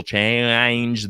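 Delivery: "change" drawn out through the vowel; stretched vowel. Shown as a demonstration. Demonstrates [name] stretching one vowel.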